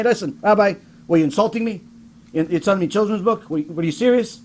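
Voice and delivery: normal talking voice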